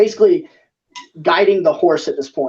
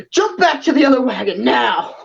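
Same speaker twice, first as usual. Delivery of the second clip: higher, urgent yelled order; 7 semitones higher than usual. Acted. Tiefling yelling to the players to jump back, urgent. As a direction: shout the order over the chaos. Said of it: "And he kinda yells".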